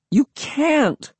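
very high-pitched protesting scream. A narrator's reading. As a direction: scream it in disbelief, without much volume. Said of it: "I screamed".

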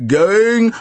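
ringing auctioneer's cry; auctioneer's call. A narrator's reading. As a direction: call it out to close the sale. Auctioneer calls before hammer falls.

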